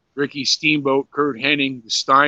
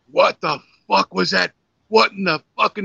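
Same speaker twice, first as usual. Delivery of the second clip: imitating an angry scold; 5 semitones higher than usual. Flair chewing them out.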